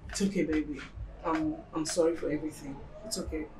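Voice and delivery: calm your partner down gently; soothing apology. Acted. Partner saying sorry for everything, soft soothing apology.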